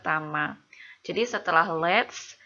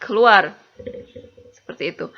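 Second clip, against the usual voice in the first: ordinary talk, nothing marked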